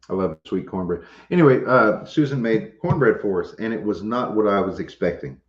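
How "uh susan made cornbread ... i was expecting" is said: ordinary talk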